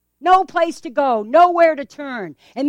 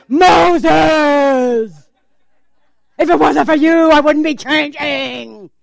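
angry blaming shout; 6 dB louder, much slower than usual. Acted. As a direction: shout Moses' name, loud and accusing, then slow down. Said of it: "they complained".

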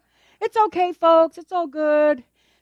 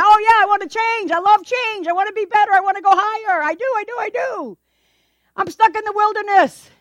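eager churchgoer; 6 semitones higher than usual. Acted.

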